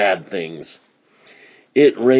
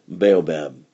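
ordinary delivery

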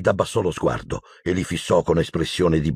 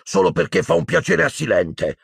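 higher-pitched indignant retort; harsh Hagrid; 8 semitones higher than usual. A narrator's reading. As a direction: protest with some harshness, pitch raised. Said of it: "esclamò aspro Hagrid". (replica aspra, tono alto)

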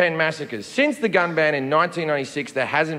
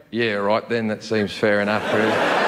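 easygoing Aussie agreement; 8 semitones lower than usual. Retold or imitated. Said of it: "And we all went".

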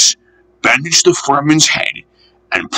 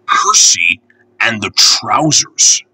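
plain reading voice

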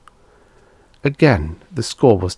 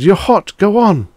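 high, loud urging shout; 12 semitones higher, 7 dB louder than usual. A narrator's reading. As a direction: shout him onward. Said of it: "the voice shouted".

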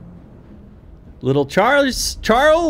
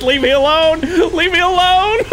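high, loud panicked yelling with laughter; real panic; 7 semitones higher, 4 dB louder than usual. Real, not acted. Player begging the monster train, laughing panic. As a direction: beg the thing to go away, laughing in fear. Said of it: "I'm panicking".

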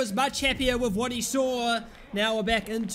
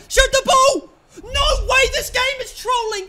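screaming at the screen, much higher and louder; frustrated yell; 14 semitones higher, 11 dB louder than usual. Real, not acted. Gamer screaming at his striker, frustrated yell. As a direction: yell in disbelief as the chance goes begging. Gamer yelling at missed shot.

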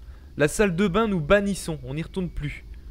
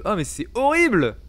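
ordinary talk

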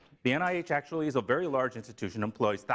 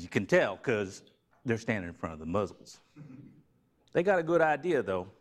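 put-on Southern accent; 3 semitones higher than usual. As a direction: play a mock Southern preacher talking to the crowd. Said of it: "Wrong accent".

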